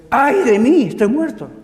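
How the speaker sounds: loud, high cry of dismay; alarmed cry. Acted. Prophet reacting to God's revelation, alarmed cry. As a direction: wail it out as one who is doomed. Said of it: "la primera reacción fue".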